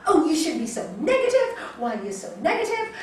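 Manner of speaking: mock-preachy scold of the husband. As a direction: lecture him like a know-it-all yogi. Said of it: "and I go".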